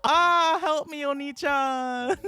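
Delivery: high, slow little-sister plea; hammed-up anime cry for help; Kokoro. Little sister calling to Oni-chan, high slow hammed-up plea.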